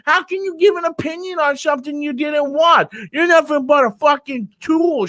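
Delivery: high, worked-up heckler voice; mocking Peter Graves. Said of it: "this is probably how you really sound".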